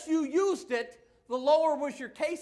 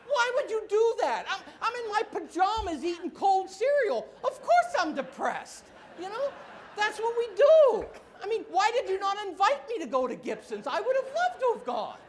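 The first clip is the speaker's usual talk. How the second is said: mock whining; 9 semitones higher, 6 dB louder than usual. Mock whining about friend's steak.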